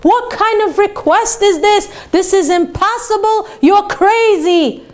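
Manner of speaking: high, slow angry outburst at a crazy request; mock general yelling, pitch up high. General yelling at the rabbi, angry shout. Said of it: "he started yelling".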